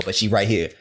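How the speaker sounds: ordinary talk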